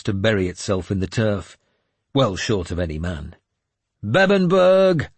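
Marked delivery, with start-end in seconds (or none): 4.0-5.1 high, loud, drawn-out battle cry; rallying shout. A narrator's reading. Warlord rallying his men in the fog, shouting. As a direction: bellow it to rally the troops. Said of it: "I shouted".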